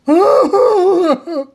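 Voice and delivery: high, loud ape howl; gorilla yell. A narrator's reading. Gorilla bitten on the nose by the baby, howling high and loud. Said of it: "the gorilla yelled".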